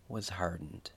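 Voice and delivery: ordinary talk, nothing marked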